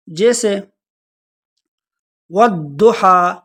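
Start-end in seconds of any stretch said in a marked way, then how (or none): 2.3-3.3 والضحا drawn out slowly, with a full heavy ض; Quran recitation demo. Shown as a demonstration. Teacher showing students the right ض, slow recitation. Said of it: "یہ دحا نہیں ہے بلکہ یہ ہے والضحا".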